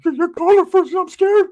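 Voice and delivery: put-on high, loud whimpering teen voice; crying cousin voice. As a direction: wail it like a scared kid cousin. Scared cousin Ricky pleading.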